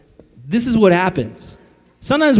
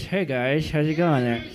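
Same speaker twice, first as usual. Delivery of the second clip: softer, teeth clenched, lips moving only; talking without moving teeth; 3 semitones lower, 6 dB quieter than usual. Shown as a demonstration. Clenched-teeth ventriloquist talk. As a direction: pastor shows the youth group clenched-teeth talking, softer. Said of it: "talk without moving your teeth".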